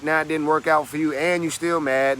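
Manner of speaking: ordinary talk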